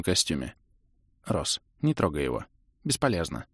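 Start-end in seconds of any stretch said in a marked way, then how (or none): 1.3-3.5 soft, low resigned voice; quiet grief. A narrator's reading. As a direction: say it without any hope left. Sister resigned over dead brother.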